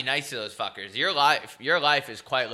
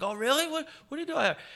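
much higher, rising voice of an amazed driver; imitating a driver who is impressed; faster than usual. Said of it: "He's like".

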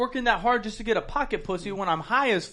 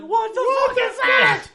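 high, slow, drawn-out shriek of disbelief; mock shocked shriek; 4 dB louder, much slower than usual. Friend spots a weird flying object, mock shocked shriek. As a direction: act stunned by a purple flying thing. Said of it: "just like".

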